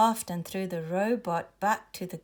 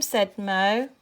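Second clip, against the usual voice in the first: ordinary delivery, nothing marked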